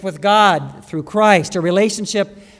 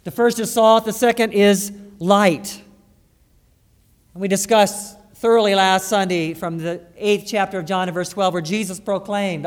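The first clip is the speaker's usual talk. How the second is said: ordinary talk, nothing marked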